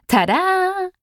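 high, drawn-out sing-out; triumphant reveal. A narrator's reading. Juno shows off the buckets she found, triumphant. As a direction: present it with a swooping, high flourish.

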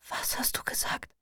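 hushed whisper, barely any voice; whispered from a hospital bed. A narrator's reading. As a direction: breathe the question out unvoiced. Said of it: "flüstere ich".

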